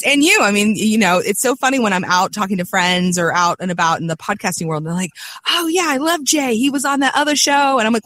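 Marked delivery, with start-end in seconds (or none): 5.5-7.8 higher-pitched quote of keen fans; enthusiastic fans. Retold or imitated. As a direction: imitate friends gushing, pitched up. Quoting enthusiastic podcast fans.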